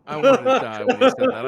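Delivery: high, loud, laughing through it. Real, not acted. Cohost recalling an embarrassing moment, high laughing squeal.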